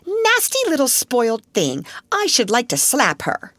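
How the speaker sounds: high-pitched, spiteful complaint; angry outburst. A narrator's reading. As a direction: break out crossly at a spoiled child. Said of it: "broke out Lavinia".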